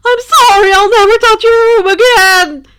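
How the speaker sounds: high, loud, near-screamed sorry; wailing apology. Acted. Denki wailing sorry to Izuku in terror. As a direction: wail it out in pure fright. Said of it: "Denki all but wailed".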